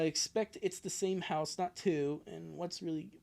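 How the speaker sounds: normal talking voice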